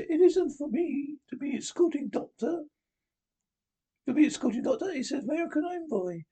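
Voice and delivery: high, prim and imperious; Margaret Thatcher impression. Prime Minister scolding the Doctor, high and prim. Reader voices Miss Thatcher's lines.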